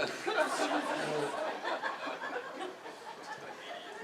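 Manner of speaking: airy high laughter; high breathless laugh. Real, not acted. Comic cracking up over his recess story, breathless laugh.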